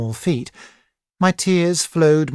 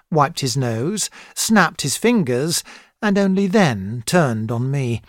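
ordinary delivery, nothing marked

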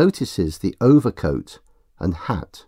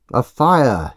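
urgent raised cry; 4 semitones higher, 6 dB louder than usual. Acted. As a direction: call out for a fire. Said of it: "he cries".

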